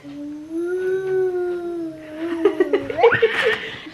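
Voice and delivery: high bubbly giggle; giggling. Real, not acted. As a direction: break into giggles. Laughing after ghost game.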